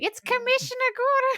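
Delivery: high-pitched mock scream; mock screaming fan. Host imagining an angry Batman fan, mock screaming. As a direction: shriek it like an outraged fan. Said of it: "someone screaming".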